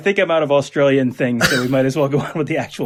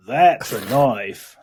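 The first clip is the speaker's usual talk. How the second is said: put-on slow Australian accent, falling at the end; hammed-up Australian movie quote; Crocodile Dundee; 3 semitones lower, much slower than usual. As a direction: stretch out the Aussie punchline. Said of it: "stuff that Crocodile Dundee says".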